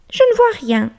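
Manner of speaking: very high little mouse report; squeaky mouse. Acted. Mouse reporting to the bear, very high. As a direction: chirp from under the bed.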